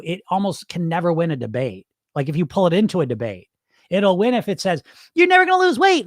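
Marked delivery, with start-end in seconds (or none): 5.1-6.1 very high, louder taunt; pitched-up mocking critic; negative inner voice. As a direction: sneer it like a bully. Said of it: "This voice".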